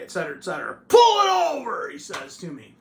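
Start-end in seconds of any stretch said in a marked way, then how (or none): 0.9-1.9 shouted police order. Retold or imitated. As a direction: holler it as a trooper's command. Retold trooper barking an order.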